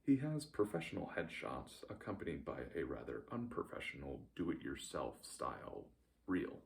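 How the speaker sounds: ordinary talk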